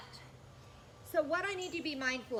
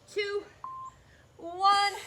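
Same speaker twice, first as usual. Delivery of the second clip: shouted countdown; 8 semitones higher, 7 dB louder than usual. Real, not acted. Coach counting down final seconds.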